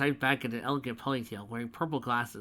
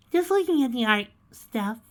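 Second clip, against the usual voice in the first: high, loud fake cheer; forced enthusiasm; 12 semitones higher, 9 dB louder than usual. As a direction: grin hard through the line. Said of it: "grinning more enthusiastically".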